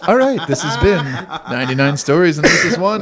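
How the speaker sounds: outro said high through laughter; laughing. Real, not acted. Host signs off the podcast while laughing. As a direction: close the show still giggling. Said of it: "signing off laughing".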